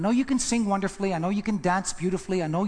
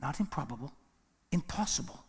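lower, much softer restatement; quiet echo; 5 semitones lower, 8 dB quieter than usual. Acted. Preacher letting the point sink in with the church, hushed. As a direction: drop it to a near whisper. Same words repeated in a hush.

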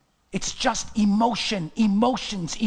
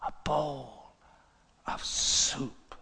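low, soft, slow with weighty pauses; hushed, solemn preaching; 6 semitones lower, 6 dB quieter than usual. Real, not acted. Preacher to friends after years of struggle, low and solemn. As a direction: speak tenderly into the quiet.

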